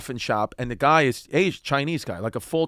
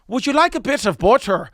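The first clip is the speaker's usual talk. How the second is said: higher Dublin lilt on the offer; Irish accent; 8 semitones higher, 3 dB louder than usual. Retold or imitated. Dublin shop guy offering butter in an Irish accent. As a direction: ask it in a lilting Irish voice.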